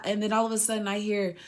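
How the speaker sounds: usual speaking voice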